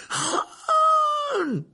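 desperate high yell; shouting. Acted. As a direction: scream his friend's name. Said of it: "hurla Harry".

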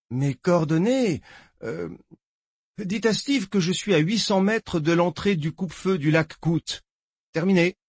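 a jittery lawman reporting in; nervous deputy voice. Acted. Deputy on the radio.

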